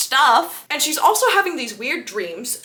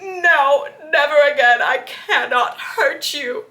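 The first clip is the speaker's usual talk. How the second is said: louder, laughing through hammed-up despair; laughing mock Edward; 3 semitones higher, 3 dB louder, slower than usual. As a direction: ham up his angst while cracking up. Edward's pity party mocked.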